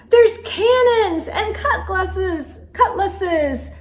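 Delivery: high, slow, laughing glee; delighted cry. Acted. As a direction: cry it out with joy. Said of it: "cried Dots".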